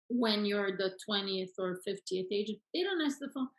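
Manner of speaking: ordinary talk, nothing marked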